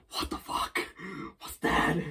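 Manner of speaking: hushed, frightened whisper; startled whisper. Retold or imitated. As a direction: whisper it in alarm. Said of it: "freaking out".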